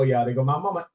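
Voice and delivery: ordinary talk, nothing marked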